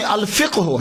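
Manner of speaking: الفقہ said as "al-fiq-hu", no vowel after Q; correct Arabic pronunciation. Shown as a demonstration. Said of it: "تو اصل میں".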